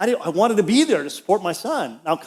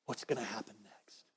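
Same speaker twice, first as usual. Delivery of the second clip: whispered in mock dread, much lower and softer; a scared little whisper to God; fearful whisper; 8 semitones lower, 23 dB quieter than usual. Preacher whispering his worried prayer to God.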